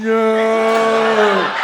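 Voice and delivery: long, loud slow-motion no; loud, drawn-out cry of horror. Retold or imitated. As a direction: stretch the no like a slow-motion film. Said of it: "watched it fly out of my mouth going".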